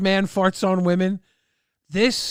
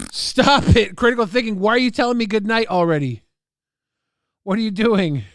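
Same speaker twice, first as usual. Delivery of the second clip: louder, higher, fast protest; playful yell; 5 semitones higher, 6 dB louder, faster than usual. Real, not acted. Streamer yells at chatter for saying goodnight, loud and fast. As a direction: shout it out in mock outrage.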